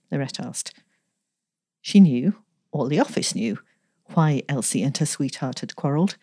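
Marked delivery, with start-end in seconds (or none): none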